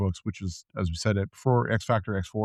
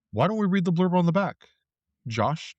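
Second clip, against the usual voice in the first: normal talking voice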